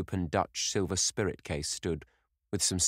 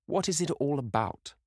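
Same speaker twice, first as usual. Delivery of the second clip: a sulky, raised-pitch outburst; petulant cry; 8 semitones higher than usual. Acted. Dorian, bored and sulky, cries out to the painter petulantly. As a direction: throw the question out like a spoiled young man. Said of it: "cried Dorian, in his petulant way".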